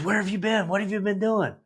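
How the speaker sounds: high, eager questions tumbling out; excited. Retold or imitated. Boss thrilled to reach the young recruit, excited and high. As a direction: pitch it way up with excitement. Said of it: "He's all excited".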